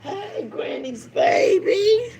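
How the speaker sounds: loud sing-song call to the grandbaby; high, drawn-out call with a wail in it. Real, not acted. Grandmother calling her grandbaby.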